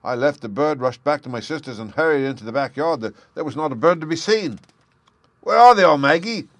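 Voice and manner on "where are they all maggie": loud, high frantic cry; cried out. Acted. Jem crying out to his sister in panic, loud and high. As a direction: call out in alarm. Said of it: "I cried".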